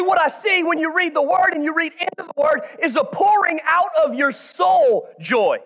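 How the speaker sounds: ordinary delivery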